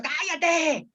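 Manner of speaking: ¡cállate! flung high and exasperated; desperate shout. Shown as a demonstration. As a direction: shout ¡cállate! high, at the end of your rope. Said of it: "Así con desesper". (¡cállate! con desesperación, agudo)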